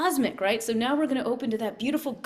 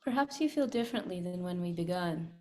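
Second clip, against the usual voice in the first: guided meditation; 2 semitones lower, 6 dB quieter than usual. Acted. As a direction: guide them inward, softly. Leads a meditation.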